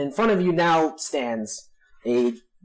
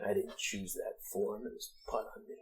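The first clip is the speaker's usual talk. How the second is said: low, very soft captive orc voice; quiet, deep orc reply; sneering orc; 6 semitones lower, 15 dB quieter than usual. Acted. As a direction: answer as the caught villain, hushed and low. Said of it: "These voices".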